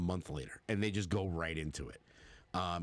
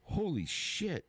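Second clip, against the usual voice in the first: stunned surprise; 8 semitones higher, slower than usual. Retold or imitated. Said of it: "you're just like".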